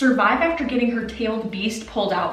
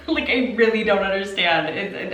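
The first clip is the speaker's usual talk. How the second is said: ordinary talk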